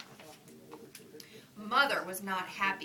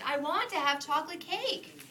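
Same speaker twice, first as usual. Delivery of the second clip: put-on whiny child; 8 semitones higher, 5 dB louder than usual. Child insisting to mother.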